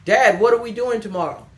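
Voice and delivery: put-on high, loud pleading kid asking dad; kid's voice. Kid pestering his dad with a question, high and loud. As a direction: play the eager child nagging. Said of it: "My children".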